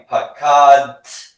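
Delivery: "Pakat" loud and high, long "ka", then a released "dt"; final consonant cluster. Shown as a demonstration. Teacher modelling for everybody to repeat, clear cluster ending "Pakat". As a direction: draw out "Pakat" and snap off both end consonants. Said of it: "consonant cluster at the end".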